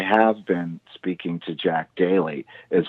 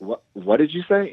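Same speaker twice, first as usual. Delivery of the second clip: stunned disbelief; 9 semitones higher than usual. Retold or imitated. Stunned reply to [name].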